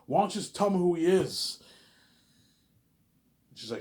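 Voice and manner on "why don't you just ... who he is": raised, higher voice of retold exasperation; acting frustrated. Hero pressing the Oracle for his name, frustrated. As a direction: demand an answer, loud and high. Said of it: "Jordan's all frustrated".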